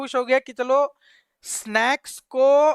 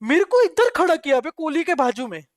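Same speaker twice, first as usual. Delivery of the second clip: ordinary delivery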